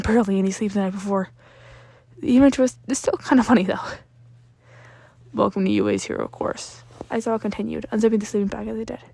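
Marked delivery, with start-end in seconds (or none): 5.3-6.8 low, bored teacher voice; deadpan low teacher. A narrator's reading. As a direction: drone it flatly. Aizawa deadpan.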